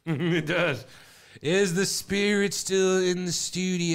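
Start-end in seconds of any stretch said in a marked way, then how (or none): 1.4-3.9 slow, hushed spooky medium calling the spirit; mock séance voice. Host playing medium to the Ouija board, slow spooky call. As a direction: drag it out like a hammy psychic.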